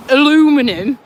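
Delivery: Aluminum said loud, a-LOO-mi-num, in four syllables; mock the American Aluminum with a loud rising ring; scornful American Aluminum. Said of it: "I mean, what word is that?".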